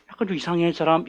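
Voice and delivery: soft, furtive mock gossip; suspicious aside. Church members judging a newcomer, suspicious. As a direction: confide it quietly, as if behind someone's back.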